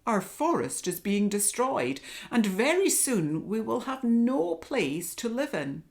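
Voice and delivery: loud, high lion voice warning of danger; lion's roar. Acted. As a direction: keep booming as the lion. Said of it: "roared the lion".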